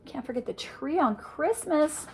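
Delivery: ordinary delivery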